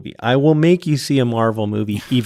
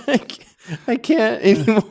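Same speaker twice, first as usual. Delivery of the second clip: high, breathless laughing; words through laughter; 10 semitones higher than usual. Real, not acted. Cracking up after old man bit.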